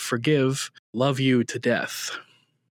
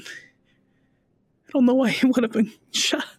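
high, trembling voice of a woman in shock; a widow just heard her husband was shot; tearful young widow; 11 semitones higher than usual. Acted. Hattie telling her friend Jack her husband died, tearful and high.

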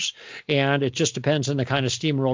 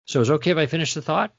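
quick polite request; 2 semitones higher, much faster than usual. Shown as a demonstration. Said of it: "I'm making a request".

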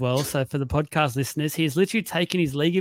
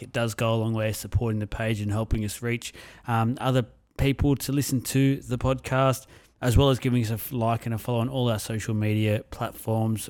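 steady, lower promo read for listeners; podcast outro read; 3 semitones lower than usual. Acted. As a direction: read the sign-off like a sponsor spot.

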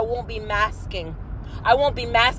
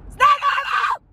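angry shout; 12 semitones higher than usual. Real, not acted. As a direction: cry it out at the top of her voice. Ranting shout at peak.

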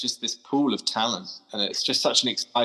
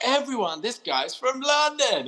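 high, slow announcing call to the whole café; shouting to the room; 13 semitones higher, slower than usual. Retold or imitated. Barista announces the London customer to the café, high and slow. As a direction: call out the news to everyone. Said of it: "she just shouts out".

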